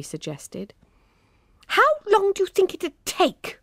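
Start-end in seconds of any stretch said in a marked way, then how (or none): 1.7-3.6 high, piercing demanding question; shrill demand. A narrator's reading. Emma shrilly demanding how long it will take. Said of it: "demanded Emma shrilly".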